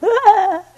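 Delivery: high, loud burst of mock gobbling; sudden shrill turkey cry breaking into laughter; imitated turkey gobble. Teacher mimicking turkeys when the bell rang, high gobbling cry. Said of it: "they all went".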